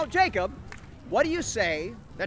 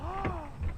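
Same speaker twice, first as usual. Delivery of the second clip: startled gasp; 7 semitones higher, 13 dB quieter than usual. Acted. Fairy godmother appears.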